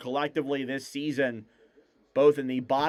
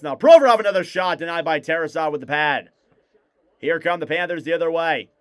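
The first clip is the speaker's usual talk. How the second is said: play-by-play; 3 semitones higher than usual. Acted. Live hockey play-by-play.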